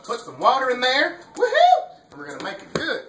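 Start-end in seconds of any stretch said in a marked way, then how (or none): 1.3-2.1 high, loud joyful whoop; excited whoop. Real, not acted. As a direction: cheer it out loud. Cheering while cooking.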